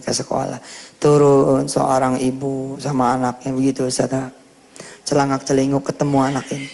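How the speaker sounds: usual speaking voice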